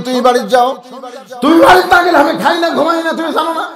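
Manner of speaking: put-on child pleading father. Child calls to jailed father.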